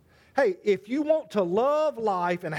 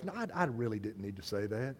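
much lower, much softer self-talk; regretful inner murmur; 10 semitones lower, 11 dB quieter than usual. Retold or imitated. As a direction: mutter it to yourself. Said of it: "you thought to yourself".

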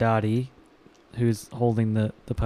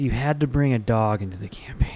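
hushed aside. Real, not acted. Player hushed reaction.

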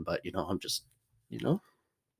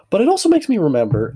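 excited burst; 9 semitones higher, 7 dB louder, faster than usual. Real, not acted.

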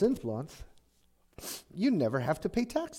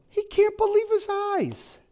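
disbelief; 5 dB louder than usual. Acted. Preacher voicing David's disbelief.

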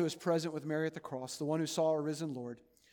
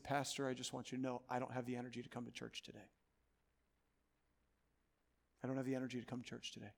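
much softer, frail retelling of a sick man's words; a dying friend's faint phone message, said softly; 3 semitones lower, 9 dB quieter, faster than usual. Retold or imitated. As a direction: let it barely carry, like a tired old man on the phone. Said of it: "he said in a very, very faint voice".

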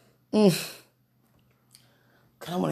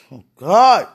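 loud burst to God; loud outcry; 13 dB louder than usual. Real, not acted. Praying alone, cries out loudly to God. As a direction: cry out suddenly. Sudden outburst in prayer.

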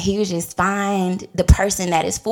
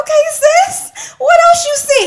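eagerly tuned in; 7 dB louder than usual. Retold or imitated. Listener presses the young seer about her future husband, eagerly. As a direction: lean in loudly, pitched way up, hungry for more. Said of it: "I'm, like, tuned in now".

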